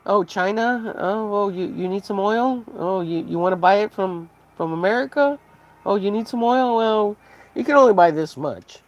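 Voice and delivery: high, mocking salesman voice; smug seller. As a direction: taunt China like a smug shopkeeper. Mock American oil seller to China.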